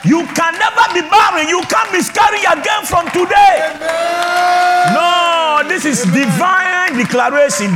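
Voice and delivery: high, loud shouted proclamation, slowing on the last line; fiery declaration. Real, not acted. Pastor declaring healing over barren women, fiery shouting. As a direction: thunder it over the congregation. Preaching declarations at full force.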